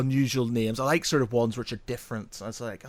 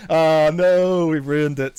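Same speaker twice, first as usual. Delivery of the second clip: normal talking voice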